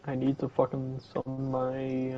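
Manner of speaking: ordinary delivery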